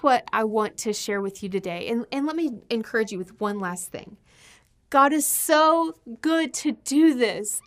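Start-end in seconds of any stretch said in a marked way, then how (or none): 4.9-7.6 higher, louder and slow, full of feeling; moved praise. Real, not acted. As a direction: let the gratitude lift the voice. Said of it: "overcome with gratitude".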